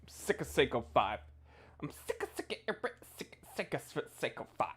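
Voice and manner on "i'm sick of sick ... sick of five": fast, high, hushed stammering; sputtering rant. Acted. Streamer spluttering his complaint while fiddling with camera. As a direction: trip over the words in frustration.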